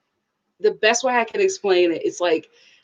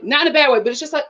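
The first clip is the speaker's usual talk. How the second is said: ordinary talk, nothing marked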